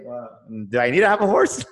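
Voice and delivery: loud mock question breaking into laughter; laughing retort. Texan retelling his comeback about owning a horse, laughing. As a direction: crack up as you deliver the comeback.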